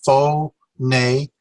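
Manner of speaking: "Pho, ne" as two beats, FOH, NAY; syllable by syllable. Shown as a demonstration. Teacher sounding out two syllables, "Pho... ne" separately. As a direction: separate "Pho" from "ne", long vowels in both. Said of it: "two syllables".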